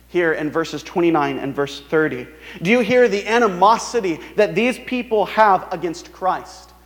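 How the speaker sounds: ordinary delivery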